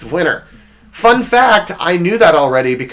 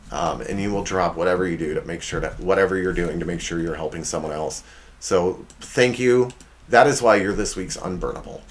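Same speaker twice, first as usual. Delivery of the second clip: softer, lower heartfelt thanks; sincere; 7 semitones lower, 6 dB quieter than usual. Real, not acted. Host thanking his best friend after the jokes, soft sincere tone. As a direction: drop the act and speak from the heart. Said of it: "All jokes aside".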